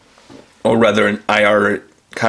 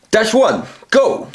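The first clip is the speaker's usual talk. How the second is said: hero command; 10 semitones higher, slower than usual. Shown as a demonstration. Reviewer demonstrates the voice phrase into the toy, high heroic shout. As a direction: bark the launch order like a rescue captain. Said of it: "use this phrase".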